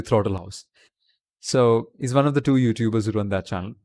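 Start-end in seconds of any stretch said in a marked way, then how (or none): 0.0-0.7 Indian-accented "Throttle House", th as hard t. Shown as a demonstration. Streamer shows how an Indian says the channel name, Indian accent. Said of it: "An Indian would say".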